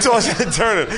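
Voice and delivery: ordinary talk, nothing marked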